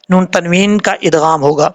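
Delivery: ordinary talk, nothing marked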